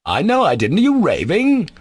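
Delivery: high-pitched incredulous outburst; agitated retort. A narrator's reading. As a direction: fling it back, pitch soaring. Ivan retorts to the accusation, rattled.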